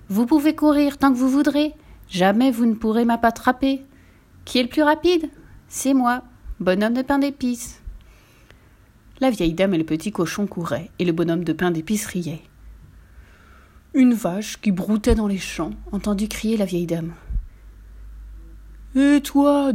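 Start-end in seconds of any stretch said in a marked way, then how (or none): 0.1-7.8 high-pitched, mildly raised storybook cry; exclaiming. A narrator's reading. Gingerbread man to his pursuers, exclaiming. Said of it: "s'écria". 19.0-19.7 high, loud storybook cow hailing someone; cow character. A narrator's reading. Cow to the gingerbread man, calling out. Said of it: "dit la vache au bonhomme".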